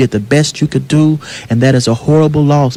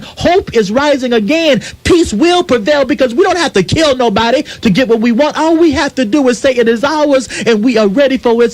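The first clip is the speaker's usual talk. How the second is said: awed onlookers; 9 semitones higher than usual. Pretend. Imagined world voicing amazement.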